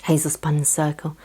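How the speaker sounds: ordinary delivery, nothing marked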